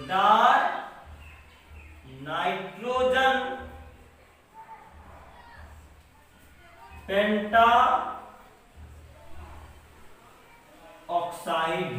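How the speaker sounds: word by word with long pauses; dictation. Shown as a demonstration. Teacher dictating a compound name for students, slow dictation. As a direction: dictate it slowly, part by part.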